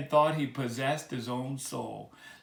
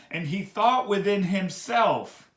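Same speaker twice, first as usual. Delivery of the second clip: slow, louder, higher, every word weighed; with emphasis; 4 semitones higher, 5 dB louder than usual. Shown as a demonstration. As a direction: lean hard on each word to show his heart. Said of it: "read this with emphasis".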